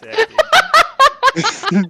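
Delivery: slow, high, laughing through the words; drawn out amid laughter. Real, not acted. Mocking the whirlpool sound effect.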